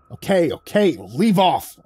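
croaked. Acted. Groggy narrator croaks at old man to leave off. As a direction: croak it out, annoyed. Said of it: "I croaked".

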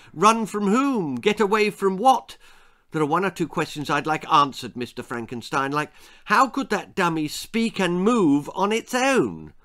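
put-on high, loud boyish questioning; insistent boy Jack. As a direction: fire questions like a persistent child.

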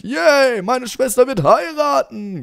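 high-pitched gleeful cheer; mock delighted brother. Player voices brother glad about sister's wedding, gleeful. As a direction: squeal happily on someone's behalf. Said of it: "Er freut sich".

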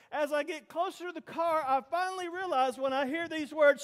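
ordinary delivery

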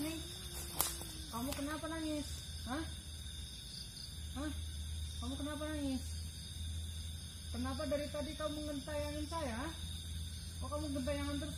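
very faint, high-pitched, far-off voice; distant talking. Real, not acted. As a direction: plead with the ghost from a distance. Talks to ghost at a distance.

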